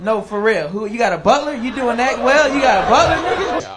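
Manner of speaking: rising incredulous shouting; disbelieving friends. Retold or imitated. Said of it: "They're like".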